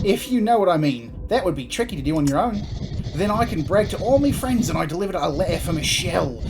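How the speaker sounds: put-on Australian-New Zealand accent. Postman bragging about delivering Michelle's letter, New Zealand-ish accent. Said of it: "my whole voice scenario just goes Australian".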